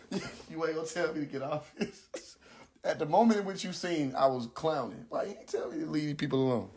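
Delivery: soft, laughing through the words; cracked up, barely getting the words out; laughing while talking. Real, not acted. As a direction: talk through a fit of giggles.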